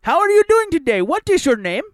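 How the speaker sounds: high, put-on foreign agent voice; hammed-up customer service rep; call center agent voice. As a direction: mimic an overly chirpy phone agent.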